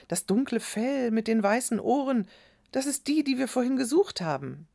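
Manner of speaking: put-on high, amazed boy's voice; excited recognition. A narrator's reading. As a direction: realise it with rising excitement. Boy recognises the lost goat. (staunend aufgeregt, hohe Stimme)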